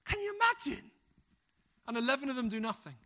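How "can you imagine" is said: much higher, amazed question; disbelieving aside to the congregation; incredulous aside. Real, not acted. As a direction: sound amazed at the idea. Aside on Jesus asking for lunch.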